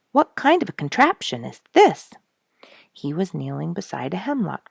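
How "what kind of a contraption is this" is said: loud, high call of surprise. Acted. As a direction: shout it across the shore to his friend. Duke calls out about a find.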